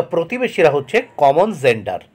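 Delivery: usual speaking voice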